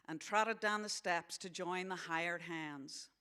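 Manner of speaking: story narration in a put-on American accent; American accent reading. Author reading a novel to an audience, American accent. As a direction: read it aloud as an American. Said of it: "gonna read this in an American accent".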